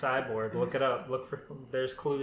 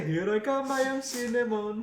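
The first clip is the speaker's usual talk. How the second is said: higher-pitched cartoon mascot voice; mock cinnamon stick mascot; 7 semitones higher than usual. Teasing a friend's old mascot impression, cartoon voice. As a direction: lightly mimic a singsong cartoon mascot. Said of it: "You were doing an impression of him".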